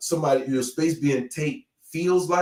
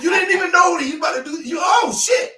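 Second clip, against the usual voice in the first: loud, high-pitched laughing outburst; worked-up reenactment; 6 dB louder, faster than usual. Acted. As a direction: shout it, high and cracking up. Acts out being grabbed, laughing.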